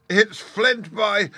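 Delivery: frightened pirate crying out; terrified cry. A narrator's reading. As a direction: cry out the name in sudden terror. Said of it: "cried Mary".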